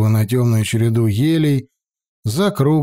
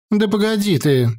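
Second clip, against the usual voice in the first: brusque; 9 semitones higher than usual. A narrator's reading. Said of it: "без всякого пиетета бросила".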